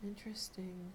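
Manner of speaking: soft unvoiced whisper. Real, not acted. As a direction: murmur it to yourself. Reacting to drawn card.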